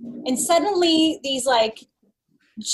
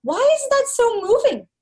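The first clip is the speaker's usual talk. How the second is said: pitched-up puzzled question; mock bewildered; 10 semitones higher than usual. Woman mocking her own tears at an ad, high and puzzled.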